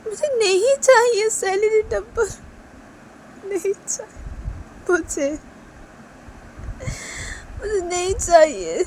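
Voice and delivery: a high, slow whimper of refusal; pleading maid. Pretend.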